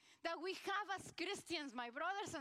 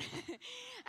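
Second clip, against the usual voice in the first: short high laugh; amused laugh; 8 semitones higher than usual. Real, not acted. Preacher to congregation after a staff member's joke, amused laugh. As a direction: break into a quick high chuckle.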